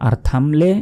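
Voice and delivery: normal talking voice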